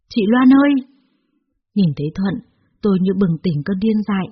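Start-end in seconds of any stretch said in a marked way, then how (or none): 0.1-0.7 called name, higher and louder; calling out. A narrator's reading. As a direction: call her name from the doorway. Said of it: "gọi tên tôi". (Thuận gọi to tên chị Loan)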